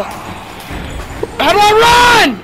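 loud, high flustered game panic; panicking gamer. Real, not acted. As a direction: panic over the controls. Friend barging in mid-game.

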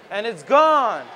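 excited announcer. Pretend. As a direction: shout it out as the ball leaves. Ball leaves the park.

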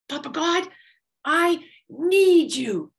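loud, drawn-out call up the mountain; big calling voice. A narrator's reading. Monkey calling up to Papa God, loud and slow. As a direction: stretch it out, calling to the sky. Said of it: "he called out in a big voice".

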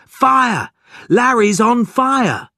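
high, loud cry of fire; panicked shout. A narrator's reading. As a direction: shout the alarm right into an ear. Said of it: "Margot shouted in his ear".

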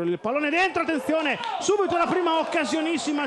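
rising, fast, shouted commentary; excited play-by-play. Real, not acted. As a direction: call the chance as it happens. Live chance in play-by-play.

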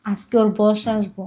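usual speaking voice

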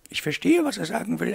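high-pitched lady's character voice; explaining mademoiselle. A narrator's reading. Eloire explains Wolf's meaning to her companion, high lady voice.

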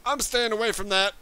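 ordinary talk

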